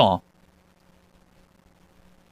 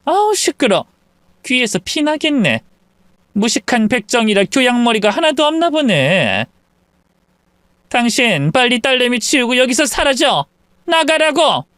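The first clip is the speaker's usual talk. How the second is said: higher, contemptuous brush-off; dismissive in-law; 7 semitones higher than usual. Acted. In-law orders them out, contemptuous. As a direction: wave them out.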